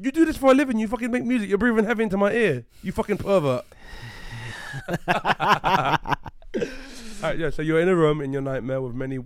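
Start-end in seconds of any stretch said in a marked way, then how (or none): 0.0-3.6 loud, high, playful scolding; mock outrage. Mock outrage at heavy breathing. 3.8-7.2 long laugh. Real, not acted. Laughing after mock rant.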